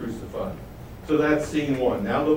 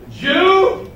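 scornful; 8 semitones higher, 6 dB louder than usual. Acted. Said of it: "See how he says".

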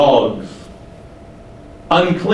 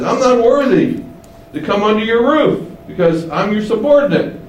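lightly acted higher-pitched protest; humble centurion; 7 semitones higher than usual. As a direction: plead unworthiness to your superior, pitched up. Acts out centurion's humble reply.

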